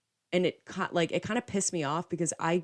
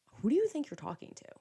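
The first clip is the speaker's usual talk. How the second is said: higher-pitched, mildly angry retold comeback; indignant retort; 8 semitones higher than usual. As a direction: snap back offended, not shouting. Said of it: "now I'm pissed off".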